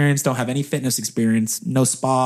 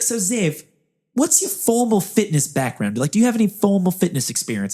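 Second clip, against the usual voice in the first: higher, questioning bad New Zealand accent; [name]; 6 semitones higher than usual. Interviewer asks about fitness background, New Zealand accent. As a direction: quiz someone in an amateur Kiwi accent. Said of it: "do a very bad New Zealand accent".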